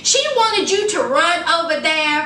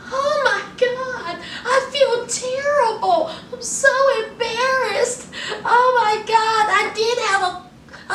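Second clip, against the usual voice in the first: high mock embarrassment; 7 semitones higher than usual. Darcy voiced embarrassed, mock.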